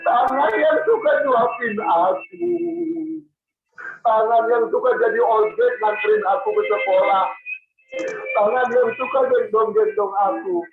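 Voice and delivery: slow, tearful wonder; weeping child Soleh. Acted. Soleh recognises his mother's hand, weeping. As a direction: draw the words out through sobs.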